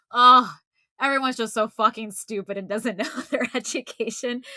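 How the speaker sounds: loud exasperated groan into a rant; mock a fed-up scientist venting. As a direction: huff it out in exasperation. Acted exasperated scientist reacting to comments.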